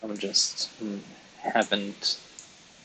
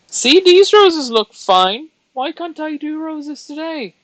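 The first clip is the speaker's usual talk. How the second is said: squeaky frustrated whine; 12 dB louder than usual. Real, not acted. Vlogger whining high about her failed roses. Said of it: "frustrated at her own roses".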